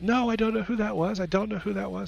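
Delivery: high, mock-giddy echo; mimicking excited manager. Therapist mocks silly manager by echoing the excitement, mimicking. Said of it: "I just kinda mimicked my manager".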